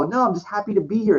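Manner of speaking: high-pitched retold plea; protesting innocence. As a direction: plead your innocence to an officer. Retelling his denial to police.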